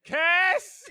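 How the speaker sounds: high, loud hollering of a name; escalated shout. Retold or imitated. Whitley yells for Cass from another room, loud imitation. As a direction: imitate her yelling for him. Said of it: "It just gets louder".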